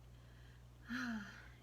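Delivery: soft, breathy sigh; weary sigh. A narrator's reading. Narrator voicing old Pat out of string, sighing. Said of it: "Old Pat sighed".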